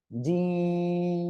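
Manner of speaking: জিম with the "ee" held long on a level note; long drawn-out. Shown as a demonstration. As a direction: intone জিম very slowly, closing on m. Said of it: "তিন আলিফ টেনে পড়তে হবে".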